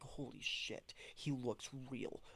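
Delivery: whispering while watching the gargoyle; awed whisper. Retold or imitated. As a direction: breathe it in hushed awe. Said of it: "and we're just like".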